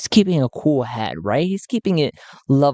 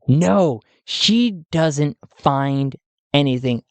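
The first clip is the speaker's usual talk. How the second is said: usual speaking voice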